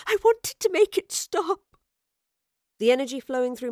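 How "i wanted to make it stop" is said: high, loud, breaking with tears; a weeping old woman's cry; sobbing. A narrator's reading. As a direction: sob it out in anguish. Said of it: "the older professor sobbed".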